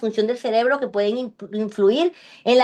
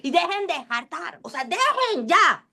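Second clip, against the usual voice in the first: mock scolding; 5 semitones higher than usual. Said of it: "le vas a echar cuatro gritos".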